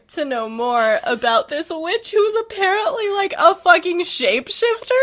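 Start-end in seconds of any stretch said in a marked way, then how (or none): none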